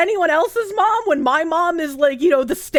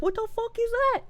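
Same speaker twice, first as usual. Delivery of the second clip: high, softer baffled voice; mock bewilderment; 9 semitones higher, 5 dB quieter than usual. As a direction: quote baffled listeners in a small high voice. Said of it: "they're like".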